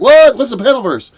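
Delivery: high, loud startled yelp; shocked what. Retold or imitated. Told where he is, yelping in shock. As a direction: snap out a shocked question.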